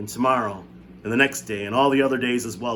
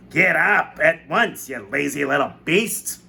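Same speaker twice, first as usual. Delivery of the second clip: slow, loud, higher scolding shout; shouting aunt; 5 semitones higher, 6 dB louder, slower than usual. A narrator's reading. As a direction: bark it down at a lazy child. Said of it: "the voice was suddenly shouting".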